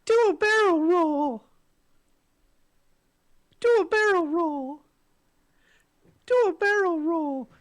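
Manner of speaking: very high, loud squeaky game-character voice; Toad voice. Acted. Co-host performing the game's iconic line, high Toad voice. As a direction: shout it up high like a cartoon toad. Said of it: "I think you did the Toad voice".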